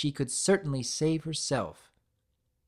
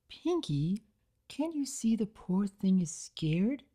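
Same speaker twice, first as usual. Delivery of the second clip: softened, plaintive; 7 semitones higher than usual. A narrator's reading. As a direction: gently plead with a friend. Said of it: "softened her tone slightly".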